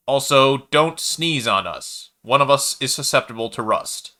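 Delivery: cold machine announcer; robotic broadcast. A narrator's reading. Servitor broadcast resumes.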